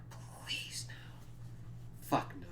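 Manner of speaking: hushed, voiceless dread; whispered plea. Real, not acted. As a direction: whisper it like a weary prayer. Dreading the next season.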